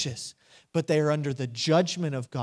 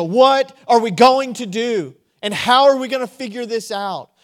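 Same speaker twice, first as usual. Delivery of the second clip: acted alarm; 6 semitones higher, 10 dB louder than usual. Acted panic at bad news.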